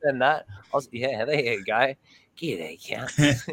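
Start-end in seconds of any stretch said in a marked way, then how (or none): 2.4-3.5 whispered mock ad greeting; the same greeting whispered to the mates as a joke. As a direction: breathe it out in a whisper. Replays his ad greeting.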